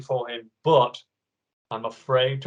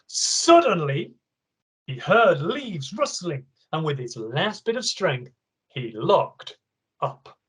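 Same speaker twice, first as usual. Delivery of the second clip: ordinary talk, nothing marked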